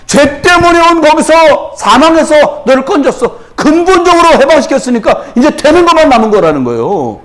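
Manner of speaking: high, ringing sermon delivery; full-force preaching. Real, not acted. Preacher assuring the congregation of rescue from every problem, impassioned preaching. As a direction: drive each promise home, high and insistent.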